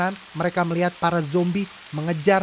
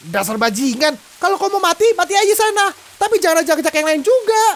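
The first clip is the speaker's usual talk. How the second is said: much higher, loud angry retort; furious shout; 10 semitones higher, 7 dB louder than usual. Acted. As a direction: snap back at him. Sister fights off the man.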